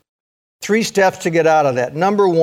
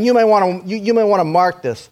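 ordinary talk, nothing marked

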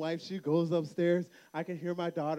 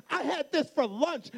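higher-pitched mock protest from a little girl; toddler attitude; 7 semitones higher than usual. Little girl to dad over her sandwich, acted-out attitude. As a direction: imitate a daughter refusing her food. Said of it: "has the attitude".

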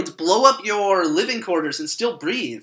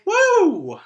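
excited woo; 12 semitones higher, 6 dB louder than usual. Acted. Third eye after space launch.

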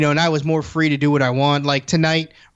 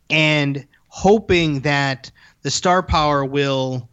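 usual speaking voice

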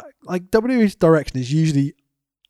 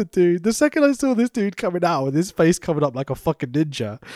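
ordinary talk

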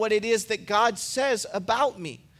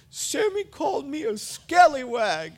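whiny upset son; 6 semitones higher than usual. Retold or imitated. Small son complaining to his dad, whiny. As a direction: tell on your brother, wounded. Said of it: "starts whining and crying".

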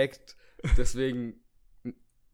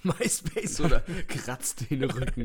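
laughing; slower than usual. Acted. Mock product announcement.

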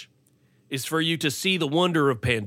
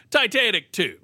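mocking; 6 semitones higher, 4 dB louder than usual. Real, not acted. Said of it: "I just have to laugh".

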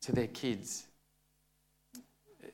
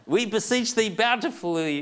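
higher, louder, hammed-up old-fashioned reverence; mock solemn kids; 10 semitones higher, 8 dB louder than usual. Kids addressing their dad in mock-solemn old English, hammed up. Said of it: "if they talk like that, it would be silly".

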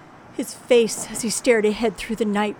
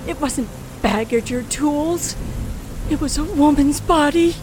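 horrified realization; 5 semitones higher, 5 dB louder than usual. Acted. Narrator reliving the moment she sees the corpse, horrified. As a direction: voice jumps up loud with horror.

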